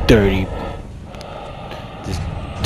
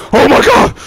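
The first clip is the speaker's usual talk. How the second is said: much higher, louder cry of shock; startled; 6 dB louder than usual. Real, not acted. Player finding the monster in his hiding spot, shocked cry. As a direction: yelp it as it jumps out. Monster suddenly inside room.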